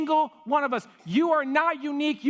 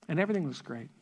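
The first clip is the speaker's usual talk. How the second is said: ordinary delivery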